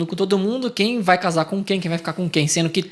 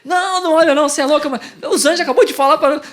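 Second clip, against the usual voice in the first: exasperated scolding; 8 semitones higher, 4 dB louder, faster than usual. Retold or imitated. Scolding the wife not to look.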